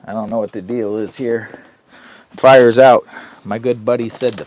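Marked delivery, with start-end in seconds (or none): 2.3-3.0 loud, higher blurt; sudden exclaim. Real, not acted. Noticing his campfire died, with a sudden loud blurt. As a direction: blurt it out in surprise.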